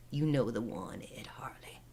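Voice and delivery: fading into a breathy whisper; eerie whisper. Retold or imitated. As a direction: hiss the name like a witch. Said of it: "she tells him".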